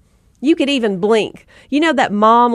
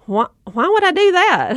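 incredulous husband; 7 semitones higher than usual. Retold or imitated. Husband asked to take the kids, higher incredulous balk. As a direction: balk at the idea like a puzzled husband. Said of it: "And he's like".

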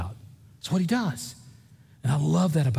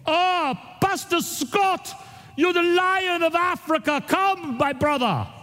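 high, slow imitated African accent; hammed-up warm Congolese welcome; Congolese friend greeting; 14 semitones higher, slower than usual. Pastor imitating his Congolese friends greeting him, high mock African accent. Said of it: "they go".

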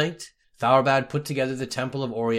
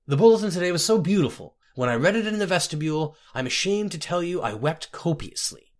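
higher-pitched, effusive archpriest; gushing archpriest; 5 semitones higher than usual. Acted. Archpriest writing to praise the scribe's bulletin, gushing. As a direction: pour on the praise as a moved archpriest.

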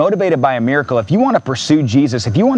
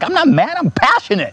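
insisting not mad; 10 semitones higher than usual. Retold or imitated. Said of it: "I'm like".

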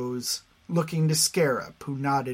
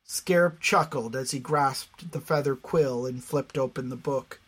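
plain reading voice

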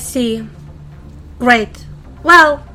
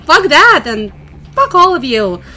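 louder, heated defiant outburst; angry defiance; 2 semitones higher, 5 dB louder than usual. Acted. Newcomer telling off the group after refusing to be killed, angry and defiant. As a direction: throw it at all of them.

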